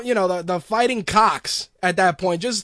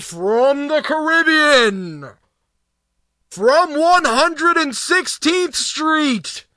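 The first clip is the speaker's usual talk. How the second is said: slow, higher, louder announcer drawl; mock ring announcer; 5 semitones higher, 5 dB louder, much slower than usual. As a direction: stretch every word like a ring introduction. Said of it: "when they come out".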